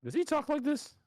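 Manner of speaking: very high-pitched mock voice; put-on mocking voice. Co-host mimicking the statement's writer, put-on high voice. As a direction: ask it in an absurdly high put-on voice. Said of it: "It doesn't sound like anybody talks like that".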